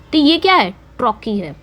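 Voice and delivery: usual speaking voice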